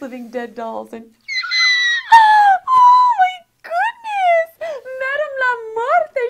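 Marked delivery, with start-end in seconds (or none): 1.3-2.1 sharp, high, loud gasp of delight. Real, not acted. Opening box sees the doll. 2.1-6.2 high, loud and slow, near-squealing delight; thrilled squeal. Real, not acted. Opening box sees the doll.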